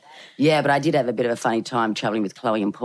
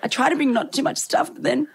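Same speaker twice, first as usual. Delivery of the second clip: defensive pleading; 10 semitones higher than usual. Retold or imitated. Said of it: "I said".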